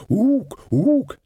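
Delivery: high, hooted ook; short high-pitched animal calls; ape-like ooking. A narrator's reading. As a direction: hoot it like an ape. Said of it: "he was going".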